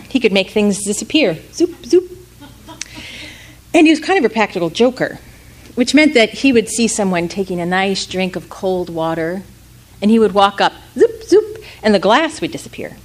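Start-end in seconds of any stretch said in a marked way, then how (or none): 1.5-2.1 high zoop, zoop wand effect; magic zoop sound. A narrator's reading. Father's wand making things vanish, high zoop. 10.9-11.6 high zoop, zoop wand effect; magic zoop sound. A narrator's reading. Father's wand making things vanish, high zoop.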